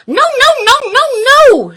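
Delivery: high, loud shout. Acted. The group shouts at the monster, high and loud. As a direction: yell at him together. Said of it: "shouted the group".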